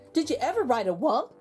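usual speaking voice

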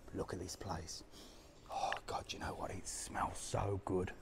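hushed, breathy whisper in the shop; soft awed whisper. Real, not acted. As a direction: whisper as if not to disturb anyone.

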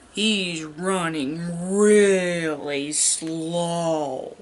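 very slow. Shown as a demonstration. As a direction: stretch every word out like a lumbering Triceratops. Showing slow as an opposite.

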